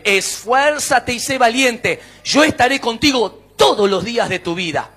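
loud, forceful preacher's proclamation; preaching declaration. Acted. Preacher proclaiming God's promises to the congregation, loud and emphatic.

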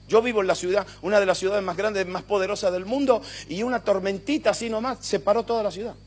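loud fervent preaching; preaching at full force. Real, not acted. Preacher warning his church about God's power, loud. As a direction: drive the point home to the congregation.

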